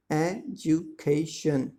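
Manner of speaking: "Education" slowly in four separate beats; split into syllables. Shown as a demonstration. Teacher counts four syllables, "Education" slowly broken into e-du-ca-tion. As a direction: stretch "Education" out as e-du-ca-tion, pausing between parts. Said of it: "four syllable".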